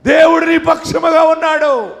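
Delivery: high, loud preacher's declaration; emphatic proclamation. Real, not acted. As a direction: proclaim it at full voice to the congregation. Same assurance repeated, building in force. (గట్టిగా, ఎత్తు స్వరంలో ప్రకటన)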